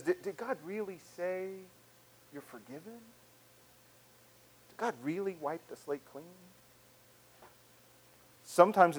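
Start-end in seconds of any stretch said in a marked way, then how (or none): 0.0-6.2 hushed, questioning voice; insinuating doubt. Acted. Voice of shame questioning forgiveness, quietly doubting. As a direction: softly cast doubt on the promise. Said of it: "It's that voice that says".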